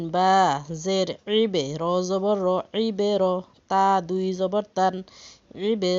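loud, spaced letter-by-letter chanting; spelling drill. Shown as a demonstration. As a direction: call out each letter for the class to follow.

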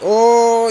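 loud, high sudden "oh"; excited gasp. Real, not acted. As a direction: burst out at the play. Big chance during match.

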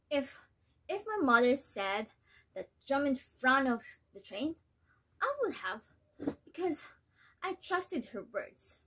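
worn-out mother, resigned and hurt; disappointed mother; weary mother. Acted. Nella recalls obedience, despairs of daughter.

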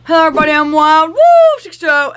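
big, high, loud intro; loud greeting. Real, not acted. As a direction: call out the channel name loudly. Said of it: "and that hurt my throat".

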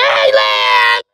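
loud, low shout of a name; calling out. Real, not acted. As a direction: yell across the room to get him. Calling out to a friend.

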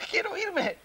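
high, cracking cry; panicked plea. Acted. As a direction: wail that you want out. Panicked man wants to leave. (grito agudo de pánico)

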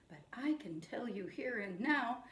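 hushed whispered echo line; whispered call-back for the kids. Shown as a demonstration. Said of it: "you say it back to me".

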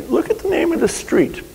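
high mock-female voice, pointing something out; imitating an amused wife. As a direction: mimic her amusement in a high voice. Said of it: "She said".

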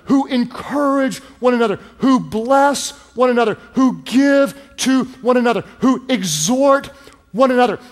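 high, impassioned preaching in a building rhythm; dramatic preaching. Real, not acted. Preacher urges the congregation toward close community, dramatic preaching at rising intensity. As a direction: preach it with mounting force, punching each phrase. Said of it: "permission to be a little dramatic".

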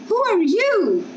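put-on high, abrupt old man's question; a stranger sizing up the newcomer; old snake man.

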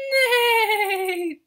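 loud whinnying neigh; high loud horse neigh. Acted. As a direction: call out like a horse. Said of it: "What does the horse say?".

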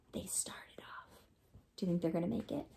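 hushed storyteller suspense; whisper. A narrator's reading. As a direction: draw the kids in with a hush.

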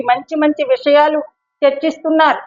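ordinary talk, nothing marked

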